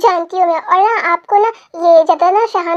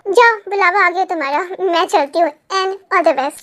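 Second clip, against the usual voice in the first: ordinary talk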